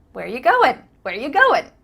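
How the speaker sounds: lively "where ya goin"; quick casual reduction. Shown as a demonstration. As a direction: toss out "Where you going", words linked. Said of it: "changed it to ya".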